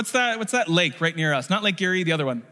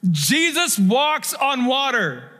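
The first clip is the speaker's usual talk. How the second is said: high, slow, punched-out exclamation; amazed proclamation; 7 semitones higher, slower than usual. Shown as a demonstration. Preacher reviving a too-familiar miracle for the congregation, amazed proclamation. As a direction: proclaim it as a jaw-dropping wonder. Preacher demonstrating awe the story deserves.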